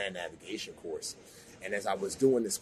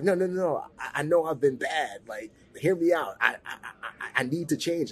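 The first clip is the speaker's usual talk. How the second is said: desperate pleading; 6 semitones higher, 7 dB louder than usual. Retold or imitated. Begging girlfriend on phone.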